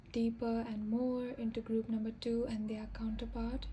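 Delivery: soft whispered request. Real, not acted. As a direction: whisper it to the cards. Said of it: "unvoiced whisper".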